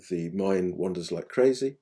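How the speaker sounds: ordinary talk, nothing marked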